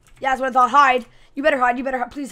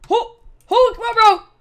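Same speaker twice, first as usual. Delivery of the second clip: ordinary delivery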